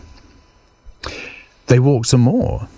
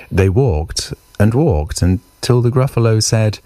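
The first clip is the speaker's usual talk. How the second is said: ordinary delivery, nothing marked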